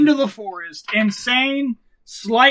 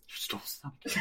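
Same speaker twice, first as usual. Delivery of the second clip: hushed whispered accusation; whispering; 11 semitones lower, 21 dB quieter, faster than usual. Acted. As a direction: whisper it menacingly. Creepy accusation.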